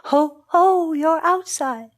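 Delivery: storyteller's echo of another's pain; echoed moan. A narrator's reading. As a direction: moan back in sympathy. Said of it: "They moaned".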